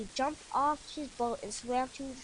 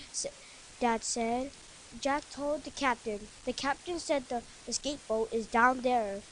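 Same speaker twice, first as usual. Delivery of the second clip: plain reading voice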